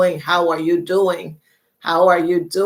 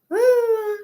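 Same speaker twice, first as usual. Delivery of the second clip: high woo. Real, not acted. Excited cheer, high.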